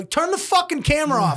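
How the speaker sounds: high-pitched, worked-up command; urgent order. Retold or imitated. Telling his friend filming the club fight, urgently. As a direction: re-enact an agitated demand.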